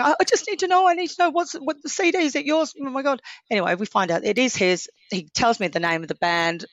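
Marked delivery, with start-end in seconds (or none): 0.0-3.2 much higher, breathless and frantic; upset and breathless. Retold or imitated. Phoning David about the CD, frantic and much higher. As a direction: beg to know, overwhelmed and out of breath. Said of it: "I sounded upset".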